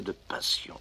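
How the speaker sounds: soft hushed whisper. A narrator's reading. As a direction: breathe it out like a secret. Narrator hushes tender moment.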